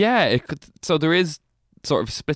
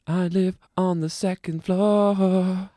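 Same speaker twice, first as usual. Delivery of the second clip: silly put-on voice; 9 semitones higher, slower than usual. Mock creature voice.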